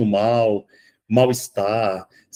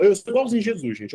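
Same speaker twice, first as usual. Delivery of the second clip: ordinary delivery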